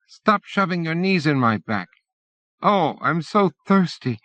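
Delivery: a hint of a cramped, thirsty kid; complaining child. A narrator's reading. Child complaining to the passenger behind him. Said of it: "A child's voice complains".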